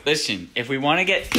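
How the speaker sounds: ordinary talk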